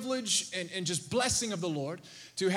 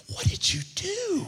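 much higher, slow, incredulous call; wife's astonished question; 11 semitones higher than usual. Retold or imitated. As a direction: gasp it out like a stunned wife. Wife asks what he did.

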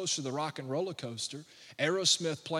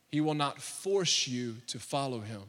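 ordinary talk, nothing marked

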